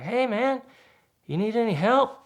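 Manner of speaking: high, called-out offer of help; friendly call. Retold or imitated. Jeep drivers from the woods hailing the stranded driver, calling out.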